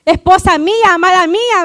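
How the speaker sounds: loud, ardent declaration to a wife; demonstrated endearment. Shown as a demonstration. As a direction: model a husband's proud declaration of love. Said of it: "Dígale". (declaración de amor enfática y fuerte)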